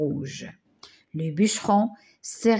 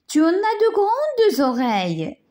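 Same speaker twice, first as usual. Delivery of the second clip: put-on higher, wondering little girl; a child marvelling at grandma's eyes, higher; 10 semitones higher than usual. A narrator's reading. Little girl marvels at the disguised wolf, higher voice.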